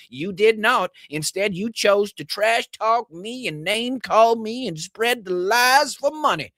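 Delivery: high, sneering mock of an angry politician; mocking Greene voice. Host reading Greene's furious tweet aloud, mocking voice. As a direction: read her tweet as a shrill caricature.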